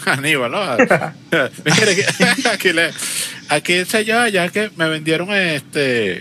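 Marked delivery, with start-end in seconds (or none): 1.7-6.2 put-on higher, laughing old-lady voice; mock the lady asking the bricklayer. Imitating the lady with ladder.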